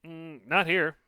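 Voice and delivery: high, loud tiny voice; little creature answering. Acted. Lily spirit voice.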